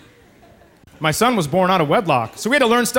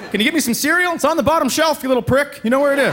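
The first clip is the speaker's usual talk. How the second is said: put-on high, exasperated snapping at kid; exasperated dad; 7 semitones higher than usual. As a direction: nag the kid, pitched up high.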